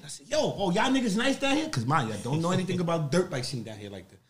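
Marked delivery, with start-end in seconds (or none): 0.3-1.7 higher, cocky trash talk; talking shit. Retold or imitated. Speaker taunting Baltimore riders, cocky and loud. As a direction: needle the locals with a cocky challenge. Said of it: "I start talking shit".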